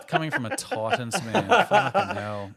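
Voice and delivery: laughing high and loud; laughing through the words. Real, not acted. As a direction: crack up while teasing. Laughing at Titans joke.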